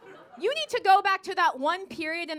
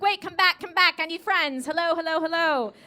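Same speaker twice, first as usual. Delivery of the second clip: higher, louder plea to come back; desperate calling out; 3 semitones higher, 3 dB louder than usual. Acted. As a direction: call after them, needy and urgent. Calling after people she wants as friends.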